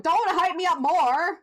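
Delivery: playfully overwhelmed outcry; excited protest. Real, not acted. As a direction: beg them to stop the hype, thrilled. Excited reaction to game hype.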